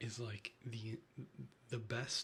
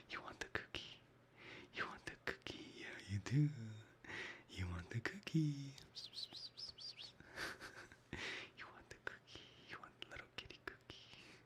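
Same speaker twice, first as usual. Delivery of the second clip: coaxing whisper to cat; 5 dB quieter than usual. Real, not acted. Offering cat a treat.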